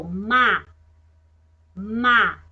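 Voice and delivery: Ma, hummed nasal M then a; teacher modelling the Ma sound for kids. Shown as a demonstration. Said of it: "A letra M de mato tem um som".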